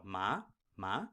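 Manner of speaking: "ma" gliding upwards from mid pitch; questioning upward slide on "ma"; rising second tone. Shown as a demonstration. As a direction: lift each "ma" like a question. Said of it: "it's simply second tone".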